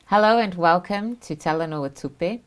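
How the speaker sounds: normal talking voice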